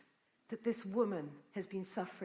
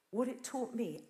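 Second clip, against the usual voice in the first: usual speaking voice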